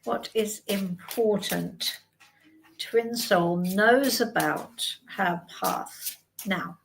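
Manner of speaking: slow, soft asking the cards; slow solemn question. Shown as a demonstration. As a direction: put the question to the cards slowly. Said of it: "let's ask then".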